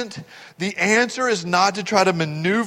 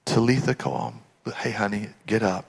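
soft, low, tender wake-up; gentle command; 6 semitones lower, 6 dB quieter than usual. Acted. Jesus waking the little girl, gentle and low. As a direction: say it quietly, like waking a child. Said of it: "He just goes".

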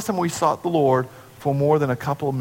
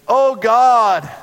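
pompous showy prayer; 8 semitones higher, 7 dB louder than usual. Retold or imitated. Pompous Pharisee praying aloud.

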